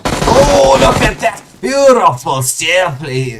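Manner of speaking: high, loud outburst of amazement; excited exclamation. Real, not acted. Caster hails a beautiful stair play, excited. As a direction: shout in admiration, then stretch out the praise.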